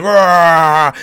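one long loud roar; drawn-out mock "rah". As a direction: imitate a noisy pack piling in with a roar. Children all come in noisily.

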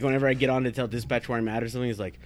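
ordinary talk

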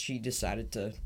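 ordinary delivery

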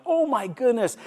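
high-pitched retold amazement; amazed exclamation. Family seeing a whale breach beside the boat, amazed. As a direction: exclaim in awe, pitch leaping up.

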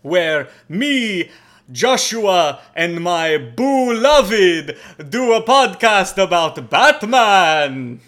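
slow, loud spooky showman voice; jack o lantern voice. Acted. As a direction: drag out each word like a haunted host. Spooky Halloween episode host intro.

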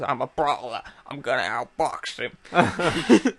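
slow, drawn-out tough-guy boxer; lightly voiced brawler, dragging the words; a hint of a movie fighter, slowed right down; Mark Wahlberg impression. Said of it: "That was me doing an impression of him".

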